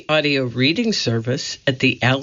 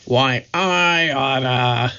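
tough gangster growl, drawn out slow; slower than usual. Acted. Moe about to slap Curly, slow tough growl. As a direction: growl it like an angry tough guy. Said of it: "Moe growled".

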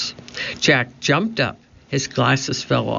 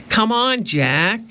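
high, urging call; shouting; 8 semitones higher than usual. A narrator's reading. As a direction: urge him on with a high, contained shout. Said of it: "Annie shouted".

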